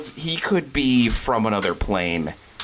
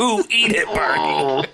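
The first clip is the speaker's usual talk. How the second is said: gleeful taunt; 6 semitones higher, 3 dB louder than usual. Pretend. Mock taunt, laughing.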